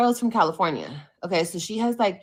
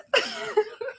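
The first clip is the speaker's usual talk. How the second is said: high laugh. Real, not acted. Laughing at Melissa quote.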